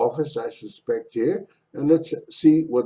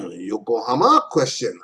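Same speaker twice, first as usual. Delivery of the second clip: ordinary delivery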